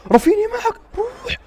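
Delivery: very high, loud yell; excited urging shout. Real, not acted. Fan sending Raphinha on the attack, high excited shout. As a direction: scream the player forward.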